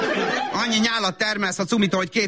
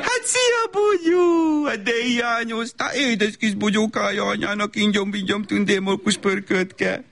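very high greeting easing into slow cooing; hammed-up doting mother cooing nonsense pet names; gushing baby talk; 4 semitones higher, slower than usual. Mother picking up her child from nursery, gushing.

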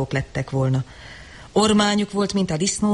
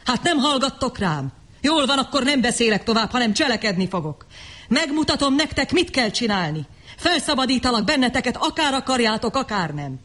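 higher, angry vow to act; defiant declaration; 6 semitones higher than usual. A narrator's reading. The Savage declaring he will free them, defiant. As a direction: threaten them with resolve. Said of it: "ordította".

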